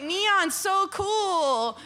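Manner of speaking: mock enthusiasm. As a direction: gush like a late trend-follower, hammed up. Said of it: "You know, like".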